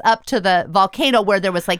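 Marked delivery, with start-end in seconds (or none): none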